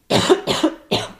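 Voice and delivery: loud dry cough; real cough into the mic; coughing fit. Real, not acted. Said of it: "noch mal husten". (heftiger Hustenanfall)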